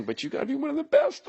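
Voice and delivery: high, fast mock sob; hammed-up blubbering; fake crying. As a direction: pretend to break down in tears.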